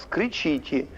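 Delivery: ordinary talk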